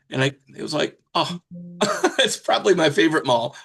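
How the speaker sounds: ordinary talk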